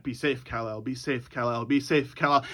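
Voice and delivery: soft, low goodbye fading as it echoes; distant echoing whisper of a farewell. Acted. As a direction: repeat it like a fading echo. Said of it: "And it's echoing".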